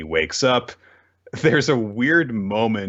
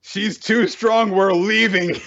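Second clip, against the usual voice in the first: mock retreat; 9 semitones higher, 3 dB louder, slower than usual. Mocking hero's retreat.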